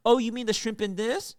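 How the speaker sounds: high, loud waitress imitation; correcting waitress. Waitress corrects his pronunciation, loud imitated correction. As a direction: pointedly correct them, pitched right up. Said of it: "like, correcting me".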